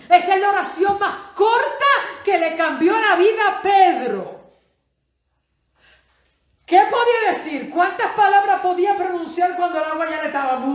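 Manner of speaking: high, loud, impassioned sermon voice; rising pulpit fervor, almost laughing; fiery preaching. Acted. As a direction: preach it at full force to the congregation. Preaches at full force.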